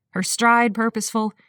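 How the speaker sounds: ordinary delivery, nothing marked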